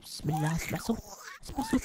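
low, hushed, wary murmur. Acted. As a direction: tiptoe and listen. Creeping in, hearing a sound.

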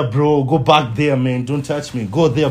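normal talking voice

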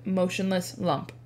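ordinary delivery

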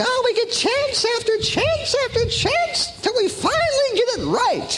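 very high, hammed-up wishful voice; mock hopeful believer. Believers hoping for endless chances, mock hopeful. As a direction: gush it high, as mock optimism. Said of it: "We'd rather think".

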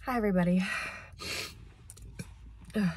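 ordinary talk, nothing marked

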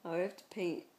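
usual speaking voice

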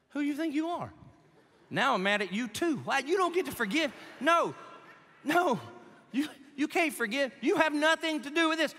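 mock the couple snapping back in disbelief; mock outraged. Offended spouse yelling at the preacher, high and indignant. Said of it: "You'd be like".

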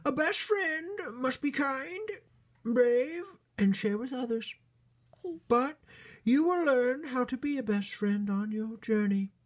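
very high, loud falsetto storybook queen; queen bunny character. Acted. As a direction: play a squeaky-high royal mother bunny.

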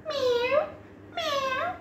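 high little meows, rising; squeaky kitten meowing. Pretend. Cat sounds in a kids' song.

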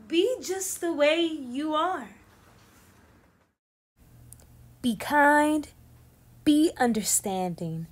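expressive picture-book read-aloud; reading to children. Acted. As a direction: read it warmly to a class. Teacher reads picture book aloud.

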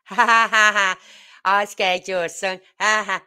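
loud jeering laugh in a put-on voice; imitating a drunk man's jeering laugh; mocking drunk laugh. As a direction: cackle like a gloating drunk. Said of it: "he's laughing".

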